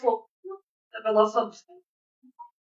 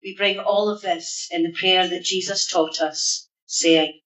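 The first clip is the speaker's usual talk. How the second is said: prayer; 4 semitones lower than usual. Acted. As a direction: lead the church in prayer. Leads a congregation prayer.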